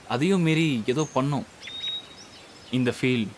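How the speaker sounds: low, intimate lover's murmur; soft romantic confession; tender reassurance. Acted. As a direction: speak low and gently, pausing between phrases. Husband tenderly reassures his wife.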